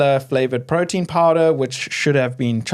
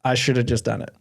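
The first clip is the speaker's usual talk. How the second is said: normal talking voice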